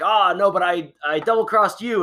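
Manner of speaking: mock one-upping. Dad retelling a Loki betraying another Loki, mock one-upping. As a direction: top the first trickster with a gleeful gotcha. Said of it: "the other one's like".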